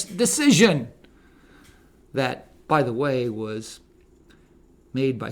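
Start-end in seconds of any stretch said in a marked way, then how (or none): none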